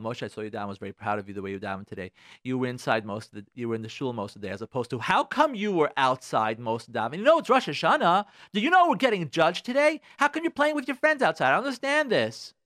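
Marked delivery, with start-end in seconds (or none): 0.0-4.5 low, soft proud praise; uplifting parent. Acted. Parent praising a young child's davening, low and soft. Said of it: "talking about lifting the self-esteem". 5.0-12.5 high, loud indignant questioning; forcing, scolding parent. Acted. Parent to child playing outside on Rosh Hashanah, high scolding. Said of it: "is that forcing your child to come in".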